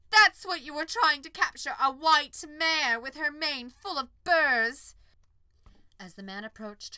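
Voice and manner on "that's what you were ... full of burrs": high, loud, slowing scorn; indignant scorn. Acted. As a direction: lash out in offended pride. Unicorn offended.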